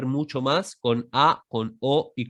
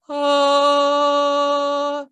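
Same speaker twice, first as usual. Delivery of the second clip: sustained ah, much higher; high-pitched vocalise; 12 semitones higher, 6 dB louder than usual. Shown as a demonstration. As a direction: hold a bright high ah. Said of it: "primero en tono bien agudo".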